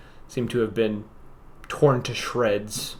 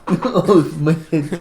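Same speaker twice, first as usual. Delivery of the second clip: higher-pitched mock Russian accent, laughing; hammed-up Russian accent through laughter; 4 semitones higher, 4 dB louder, slower than usual. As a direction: echo it in a jokey Russian accent. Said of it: "do my Russian accent".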